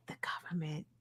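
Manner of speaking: hushed secretive whisper, imitating; conspiratorial whisper. Coworker's conspiratorial line retold.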